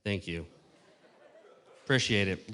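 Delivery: strained, tired voice; hoarse from talking too long; losing voice. Real, not acted. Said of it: "Losing my voice here".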